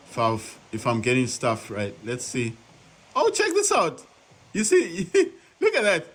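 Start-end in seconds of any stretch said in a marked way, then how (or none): 3.2-6.1 high, delighted, laughing at the view; thrilled discovery, a laugh breaking in; excited. Real, not acted. Amazed by game camera.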